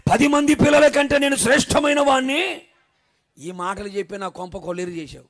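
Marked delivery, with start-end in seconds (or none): 0.1-2.6 louder and higher, proud proclamation; husband proclaiming to his wife, loud; bold declaration. Shown as a demonstration. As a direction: announce it proudly to the crowd. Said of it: "ఆ, చెప్పు, చెప్పు, చెప్పు".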